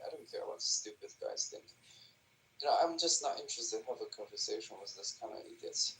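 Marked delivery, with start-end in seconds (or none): 0.1-1.6 breathy whisper under the breath, much softer; a real whispered put-down, meant only half to be heard; whispered aside. Real, not acted. Dismissing the guy on the voice chat in a whisper. Muttered aside during heated argument.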